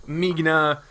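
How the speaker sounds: "Migna" tried as MIG-nuh, hard g; trying out pronunciation. Shown as a demonstration. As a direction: test "Migna" with a hard g and no middle vowel. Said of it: "forgot what the proper pronunciation was".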